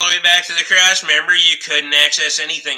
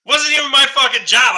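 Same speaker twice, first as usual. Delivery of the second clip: higher and louder, exasperated; angry outburst; 9 semitones higher, 6 dB louder than usual. Real, not acted. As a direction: blurt it out, fed up. Repeats complaint, angrier.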